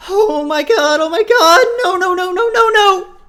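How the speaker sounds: very high, full-volume screaming; terrified shriek. A narrator's reading. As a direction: scream in blind panic, words tumbling out. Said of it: "The shriek that Raven lets out".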